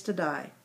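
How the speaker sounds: plain reading voice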